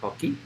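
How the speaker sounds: high rising chirp imitating the frog; coqui frog call. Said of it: "The males are the ones that typically go coqui".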